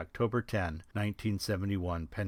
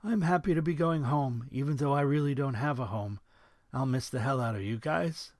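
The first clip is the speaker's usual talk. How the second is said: voice cracking; 6 semitones higher than usual. A narrator's reading. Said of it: "his voice cracking".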